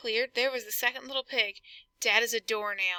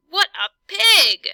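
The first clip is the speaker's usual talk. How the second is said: loud, higher, slow outraged cry; wolf fuming at the rude pig, loud and slow; indignant; 8 semitones higher, 10 dB louder, slower than usual. Acted. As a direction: let the outrage burst out.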